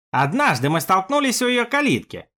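mechanical, robot-like delivery. Acted. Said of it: "Говорил он механически, как робот".

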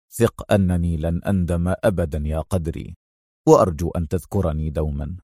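low, soft, tender reassurance. A narrator's reading. Adham patting Qadri's shoulder in farewell, tenderly. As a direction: comfort a friend in a low, quiet voice. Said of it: "ربت أدهم على كتفه في حنان وهو يقول".

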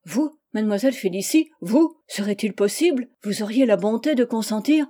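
acted astonishment, voice lifting on the questions; can hardly believe the kindness offered; incredulous surprise. Humble man gets an unexpected offer.

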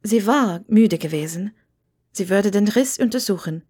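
ordinary delivery, nothing marked

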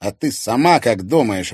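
loud, irritated retort; angered. A narrator's reading. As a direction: throw the question back crossly. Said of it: "разозлился Тимофей".

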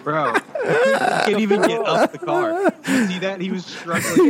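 high, loud, laughing disbelief; cracking up at the screen. Real, not acted. Laughing at the fat foot soldier.

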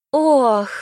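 high, loud "oh" of distress; theatrical gasp. Acted. Elf fakes fainting for the cameras, gasping. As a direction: swoon on a drawn-out gasp.

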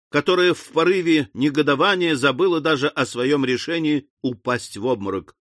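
plain reading voice

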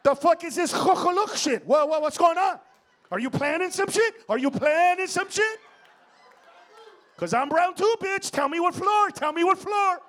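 high, loud, frantic demand; hammed-up alarmed yelling; panicked shouting. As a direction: yell suspicious questions in alarm. Acted panic in the elevator.

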